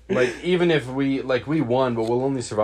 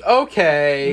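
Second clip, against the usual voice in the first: sudden loud okay; 5 semitones higher, 9 dB louder than usual. Retold or imitated. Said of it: "And he says".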